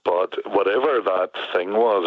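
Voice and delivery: ordinary talk, nothing marked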